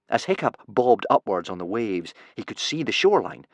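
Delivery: ordinary delivery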